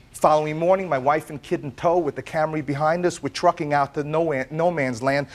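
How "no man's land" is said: ordinary talk, nothing marked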